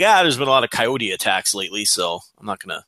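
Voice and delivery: low, offhand retelling of bad news; mock casual. Co-host mimics the other's coyote remark, mock casual. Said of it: "you said it so casually".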